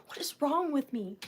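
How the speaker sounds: hushed whisper, half to herself; breathy self-mocking whisper; whispered aside. Real, not acted. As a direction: whisper it like a guilty confession.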